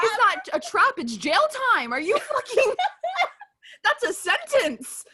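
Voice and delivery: high-pitched, laughing indignation; mock outrage. Co-host riffing on the trap being jail time, mock outrage. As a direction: squeal the protest in disbelief.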